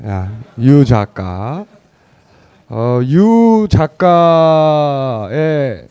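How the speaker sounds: slow teasing drawl on 유 작가; drawn-out address. Real, not acted. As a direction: stretch out 유 작가 playfully. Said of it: "유 작가라고 부르겠습니다".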